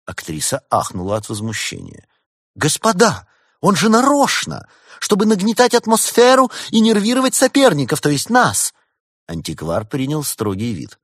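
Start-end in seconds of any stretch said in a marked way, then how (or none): 2.5-8.7 high, loud indignant protest; outraged, accusing the boy; 1 indignant outburst. A narrator's reading. Возмущённая актриса обвиняет. (актриса возмущённо кричит, высоко и громко)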